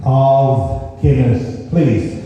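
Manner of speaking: very slow, drawn-out words; slow, sing-song order at the bar; speaking slowly. Acted. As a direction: spell it out slowly for a foreigner. Speaking slowly to Spanish barman.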